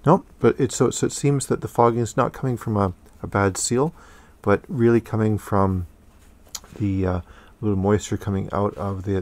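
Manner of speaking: muffled behind respirator; speaking into mask fabric; through a face mask. Real, not acted.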